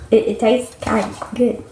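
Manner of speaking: ordinary talk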